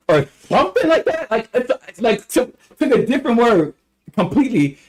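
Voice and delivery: high, squeaky exasperation; exasperated disbelief. Real, not acted. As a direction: throw up your hands in words. Exasperated comment on the mispronunciation.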